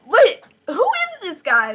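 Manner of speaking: high, loud burst of disbelief; incredulous outburst. Acted. Tessa questions Miranda about the groom, incredulous outburst. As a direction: demand it, shocked and high.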